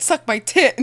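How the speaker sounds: high, loud silly command; mock Papa order. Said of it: "Papa said".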